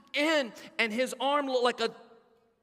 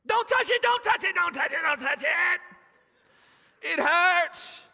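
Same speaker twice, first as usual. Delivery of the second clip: put-on much higher, louder screaming kid; screaming child; 7 semitones higher, 5 dB louder than usual. Boy at doctor's office screaming in pain, high and loud. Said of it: "screaming his head off".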